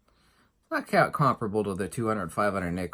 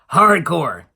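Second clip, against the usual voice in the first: loud, punchy answer with hardcore hammered; emphatic shout; 4 semitones higher, 11 dB louder than usual. Acted. Vlogger answering his own rhetorical question with a loud shout.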